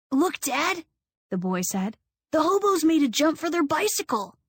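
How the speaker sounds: put-on a small boy's high excited voice; little boy. A narrator's reading. Little boy showing his dad the trail, excited. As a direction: pitch way up like an eager child.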